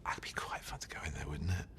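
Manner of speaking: soft voiceless whisper suggesting a bit of fun; sly whisper. Retold or imitated. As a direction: whisper it like a mischievous plan. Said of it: "and I went".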